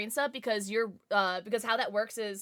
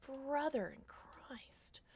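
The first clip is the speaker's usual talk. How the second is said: breathy mock whisper; 4 semitones higher, 11 dB quieter, much slower than usual. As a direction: whisper it slowly. Unvoiced whisper ending.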